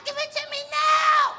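put-on high child screaming demand; screaming kid. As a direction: tantrum yell at mommy. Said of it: "kicking and screaming at his mother".

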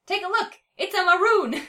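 high and loud, thrilled; excited call. A narrator's reading. Cat calling from the tree, excited. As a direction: shout the find down below.

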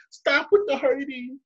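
high, slow clown plea; high slow goofy plea; silly clown voice. Retold or imitated. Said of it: "He's like".

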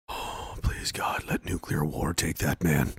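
low, slow, hushed plea; mock despairing prayer. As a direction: whisper the oh, then pray low and weary. Mock prayer after the news.

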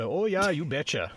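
cheery Wisconsin accent impression. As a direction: play a folksy Wisconsin local. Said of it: "Your Wisconsin accent is different than the Wisconsin accent that I heard".

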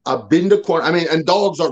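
normal talking voice

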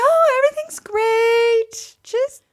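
forced bright, high reassurance covering panic; strained mock cheer on the phone; fake cheerful. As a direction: lie brightly that all is well. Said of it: "I was like".

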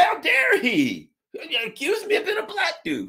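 hammed-up squeaky fury; mock outrage. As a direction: fake being furious over nothing.

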